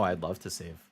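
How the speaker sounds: ordinary talk, nothing marked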